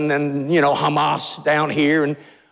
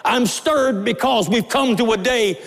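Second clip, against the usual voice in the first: fervent preaching; 5 semitones higher than usual. Acted. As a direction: press the church with burning conviction. Preaching builds up.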